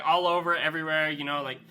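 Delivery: usual speaking voice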